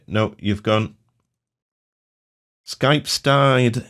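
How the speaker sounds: ordinary delivery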